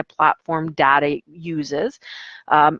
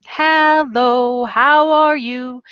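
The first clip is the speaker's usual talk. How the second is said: higher, slower, stretched hello; sing-song teacher greeting; 6 semitones higher, 3 dB louder, much slower than usual. Shown as a demonstration. Teacher greeting young students online in a sing-song voice. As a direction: greet a child brightly and slowly. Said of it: "I go".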